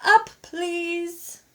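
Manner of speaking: high, resonant booming request; ringing big voice. A narrator's reading. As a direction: ring it out like a church bell. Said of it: "voice rang out like a church bell".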